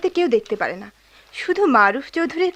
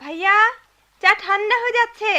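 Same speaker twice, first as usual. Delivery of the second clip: raised, high call to siblings; 6 semitones higher, 3 dB louder than usual. Acted. Sister summoning sister and brother to tea, calling out. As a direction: holler to another room.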